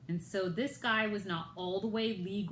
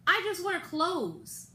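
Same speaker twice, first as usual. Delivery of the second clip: exasperated reply; 9 semitones higher than usual. Retold or imitated. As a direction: act baffled at a silly accusation. Retelling her own baffled reply.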